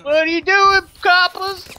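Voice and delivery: high, loud outraged mock shout; mock yelled protest. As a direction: yell it at the coppers, hammed up. Acting out arrested man yelling.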